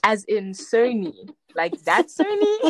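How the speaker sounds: rising, disbelieving, slow then laughing; can't believe it's really Sony; slow, disbelieving, laughing as the pitch climbs; incredulous. Retold or imitated. Retold disbelief at Sony call.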